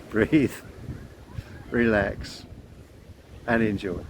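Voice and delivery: slow closing mantra with pauses; calm mantra. Shown as a demonstration. Closing the video for viewers with a calm mantra. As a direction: end the talk on a calm, slow chant. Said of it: "let's finish on it".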